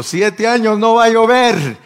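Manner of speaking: high, loud shouted decree; prophetic proclamation. Acted. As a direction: cry it out like a prophet pronouncing judgment. Acts out Elijah's proclamation.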